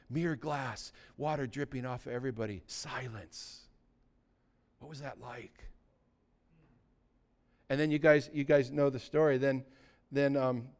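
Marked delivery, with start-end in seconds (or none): 0.1-5.7 soft, low wonder, fading to near silence; quieting down in reverent amazement; hushed awe. Real, not acted. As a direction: paint the stillness in a hush. Describing sudden calm after storm.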